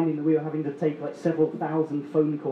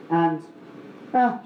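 ordinary talk

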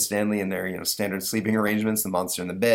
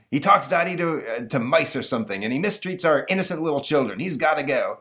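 higher, forceful angry-dad voice; pushy, raised-voice caller; blustering Bilge Barker; 7 semitones higher than usual. Acted. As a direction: pitch your scheme like a pushy father.

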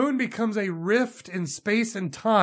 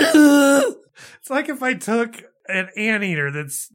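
mock dying scream; 5 semitones higher, 6 dB louder, slower than usual. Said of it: "she goes".

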